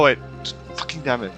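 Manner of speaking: ordinary talk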